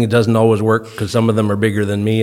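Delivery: ordinary talk, nothing marked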